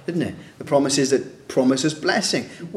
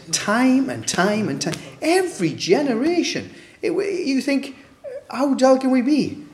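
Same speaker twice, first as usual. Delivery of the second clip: exasperated disbelief; 10 semitones higher than usual. Real, not acted. Preacher marvelling at the people's dullness, exasperated. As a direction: pitch leaps up in disbelief.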